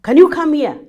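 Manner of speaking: high, loud summons; forceful tone. Shown as a demonstration. Teacher shows how tone changes meaning, forceful summons. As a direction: raise pitch and volume sharply. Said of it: "the tone, even the inflection".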